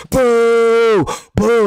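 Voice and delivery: loud mock booing crowd; imitating crowd booing. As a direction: boo him like a hostile crowd. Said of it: "and everybody like".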